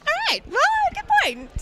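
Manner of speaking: high, playful concession; a hint of a high, amused voice; mock grudging agreement. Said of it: "and I'm like".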